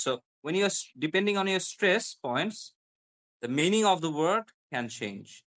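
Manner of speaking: ordinary talk, nothing marked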